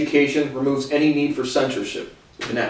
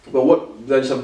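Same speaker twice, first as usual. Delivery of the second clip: ordinary delivery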